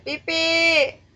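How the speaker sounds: loud call across the map; shouting a friend's name. Real, not acted. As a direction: call out for your lost friend. Calling for missing friend.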